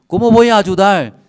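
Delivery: loud, high acted outburst; exasperated king's retort. King unable to help the starving woman, loud acted exasperation. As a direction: protest loudly, as a helpless king.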